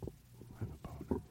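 hushed whispered aside. Real, not acted. Host whispering an aside off mic. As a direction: whisper it so the mic barely catches it.

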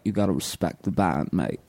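low, lightly British rocker; lightly British rock star. Retold or imitated. [name] doing an eighties British rock star, low and lightly accented. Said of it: "[name] doing nineteen eighties British rock star".

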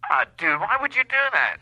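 nose pinched shut; holding nose. Acted. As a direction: voice squeezed through a held nose. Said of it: "I'm holding my nose".

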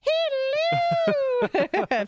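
high falsetto nanny hello; Mrs. Doubtfire. Retold or imitated. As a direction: chirp it as a sing-song old nanny. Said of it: "Missus Doubtfire".

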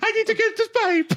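very high falsetto squeal; mock-desperate, far above normal pitch; hammed-up character voice. Imagining Leto climbing into a pipe.

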